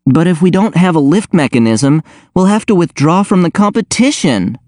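high-pitched worried protest; distraught. A narrator's reading. Abigail fearing the team must withdraw from the competition, distraught. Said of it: "Abigail was distraught".

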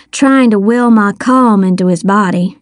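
ordinary delivery

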